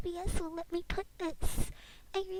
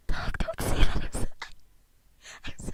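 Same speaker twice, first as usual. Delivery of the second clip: unvoiced whisper close to mic; anxious whisper; 14 dB louder, faster than usual. Real, not acted. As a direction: whisper it nervously.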